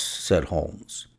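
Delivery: usual speaking voice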